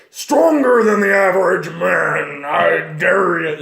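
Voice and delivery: slow, loud, growled brute challenge; half-orc voice. Shown as a demonstration. Half-orc squaring up to a weaker foe, slow and loud. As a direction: loom over them, daring a fight. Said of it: "vocal cords should probably be a little bit deeper".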